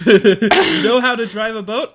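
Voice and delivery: laughing question in disbelief. Real, not acted. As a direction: ask it through laughter.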